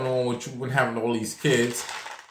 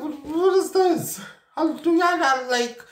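shrieking disbelief. Retold or imitated. Hearing another patient is about to die, squealing high in disbelief. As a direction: freak out in mock horror. Horrified reaction to nurses.

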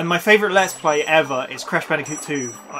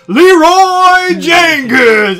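drawn-out battle cry, high and very loud; Leroy Jenkins; 11 semitones higher, 12 dB louder, much slower than usual. Retold or imitated. Retelling the raid clip, long mock battle cry. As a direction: bellow the name slowly like a charging warrior.

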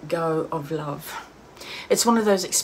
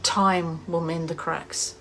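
reflective dramatic reading with long pauses; measured, pensive recital, fading at phrase ends; wistful monologue. Acted. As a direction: deliver a heartfelt soliloquy on loss, phrase by phrase. Performs a written monologue about loss.

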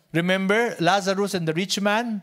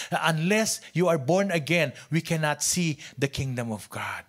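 normal talking voice

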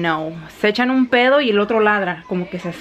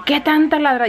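higher, exasperated mock telling-off; scolding like children; 7 semitones higher than usual. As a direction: retell scolding dogs as if they were kids. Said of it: "Yo regañándolos como si fueran niños". (regañando como a niños, tono agudo)

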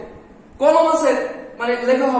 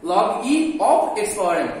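slow reading aloud; 2 semitones lower, slower than usual. Shown as a demonstration. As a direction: say the whole English reading slowly, word by word. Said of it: "অথবা বলতে পারো". (ধীরে ধীরে উচ্চারণ করে দেখানো)

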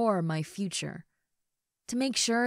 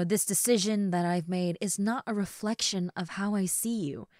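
ordinary talk, nothing marked

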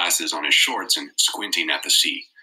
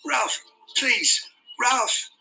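shouting; much slower than usual. A narrator's reading. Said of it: "Piggy shouted".

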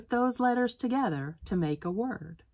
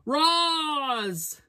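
yelled name; 10 semitones higher than usual. A narrator's reading. Boss yelling for the lunch lady, high call. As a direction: boss calling her name, high and sharp. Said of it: "the boss yelled".

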